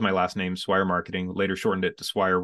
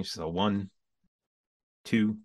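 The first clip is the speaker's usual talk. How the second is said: ordinary delivery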